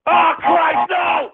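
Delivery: high-pitched yell of dismay; exasperated outburst. Real, not acted. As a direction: cry out in outraged disbelief. Host erupts at prank sound.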